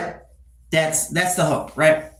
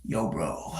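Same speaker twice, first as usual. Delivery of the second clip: low dude voice; 11 semitones lower, 10 dB quieter than usual. Acted. Acted dude approaching.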